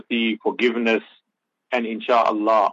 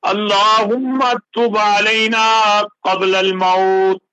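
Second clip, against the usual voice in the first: Quran recitation; 6 semitones higher than usual. Acted. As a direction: recite with measured, chanted phrasing.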